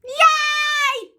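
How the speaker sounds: high, loud cheer; celebrating. Real, not acted. Cheering herself after the birthday wish, high shout. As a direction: shout a long, delighted yay.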